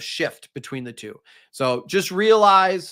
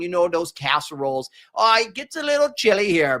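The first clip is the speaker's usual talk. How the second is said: high and loud, hammed-up Minnesotan accent; mock Minnesotan accent; 4 semitones higher, 4 dB louder than usual. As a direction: play a cheery Minnesota host with a thick Minnesotan accent. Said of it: "who would be like".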